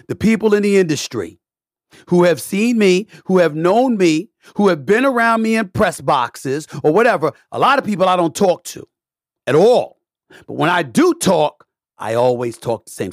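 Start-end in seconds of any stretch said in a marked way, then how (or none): 0.1-11.7 heated rant. Real, not acted. Angry rant at critics.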